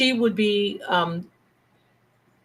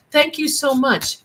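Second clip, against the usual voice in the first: ordinary talk, nothing marked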